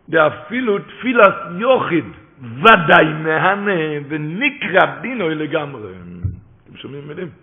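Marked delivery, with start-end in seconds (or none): 0.1-5.9 loud, slow, stretched-out declaration; fervent preaching. Real, not acted. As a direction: hammer each word home slowly. Preacher cites the Or HaNer's words. (הכרזה רמה ואיטית בלהט)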